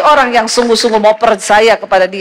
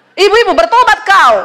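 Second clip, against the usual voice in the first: higher, louder rebuke to the wives; fiery call; 9 semitones higher, 4 dB louder than usual. Real, not acted. Preacher scolds the wives in the congregation, high and forceful. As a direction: call them to repent, hard.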